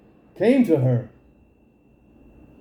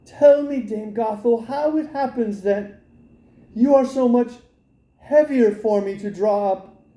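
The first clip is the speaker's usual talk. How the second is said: put-on girl's voice; 4 semitones higher than usual. Rapunzel innocently asking Dame Gothel, girlish and high. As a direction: blurt it out like a naive girl. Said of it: "Rapunzel said to her".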